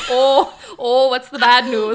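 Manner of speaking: loud, hammed-up Minnesota accent; mock the Minnesota accent, laughing and loud. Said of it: "like, a Minnesota accent".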